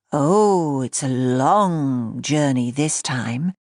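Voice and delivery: slow, smooth velvety conductor announcing the trip; smooth conductor's drawl. A narrator's reading. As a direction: uncle playing conductor for his niece, velvety and slow. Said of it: "in an entirely new voice, smooth, velvety, a conductor's drawl".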